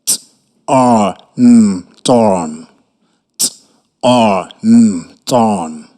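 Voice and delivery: letters "T-O-N" low, then "torn"; spelling it out. Shown as a demonstration.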